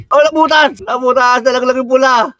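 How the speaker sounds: high, dismayed cry; reacting to the sheep falling through; sudden high-pitched shock; alarmed. Real, not acted. Animal fell through hole.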